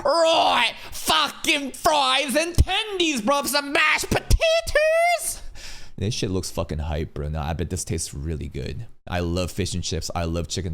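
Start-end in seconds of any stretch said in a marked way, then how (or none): 0.0-5.4 high, hammed-up British yelling; over-the-top mock British lad; bad British accent. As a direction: holler in a terrible British accent, stretching the words. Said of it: "trying to do my shitty ass British accent".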